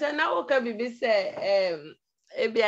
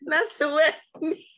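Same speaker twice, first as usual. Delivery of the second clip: higher-pitched, talking through laughter; laughing; 4 semitones higher, 4 dB louder than usual. Real, not acted. Caller making a point to the host, laughing. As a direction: push the words out between laughs.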